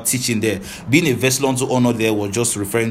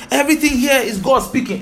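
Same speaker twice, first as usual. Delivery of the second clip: mocking quote; 13 semitones higher, 3 dB louder than usual. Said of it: "a lot of people go say".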